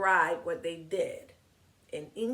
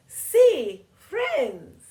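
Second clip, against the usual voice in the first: put-on higher, louder girlish voice; excited girl character; 8 semitones higher, 11 dB louder than usual. Girl in the picture book answering yes to friendship. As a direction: pipe up happily as the little girl. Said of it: "Y Susan says".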